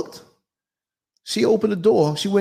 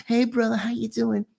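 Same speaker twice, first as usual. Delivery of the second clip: funny voice; 6 semitones higher, 4 dB quieter than usual. Retold or imitated. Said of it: "that's the way he sounded".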